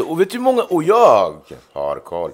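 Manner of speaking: high, loud boast; pumped-up bragging; excited brag. Real, not acted.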